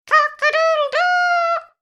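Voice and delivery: very high, loud crowing; rooster crow. A narrator's reading. Rooster wakes the farmyard with high loud crow. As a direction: crow like a rooster with a long last note. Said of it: "crowed the rooster".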